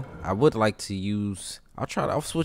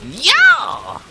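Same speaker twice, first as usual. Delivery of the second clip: high excited whoop; excited yell; 6 dB louder than usual. Real, not acted. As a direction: yell it out in triumph. Landing a hit.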